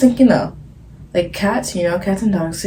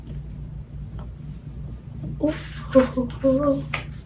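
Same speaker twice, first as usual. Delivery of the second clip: soft chuckle; 7 semitones higher, 8 dB quieter than usual. Acted. Playful hum-laugh.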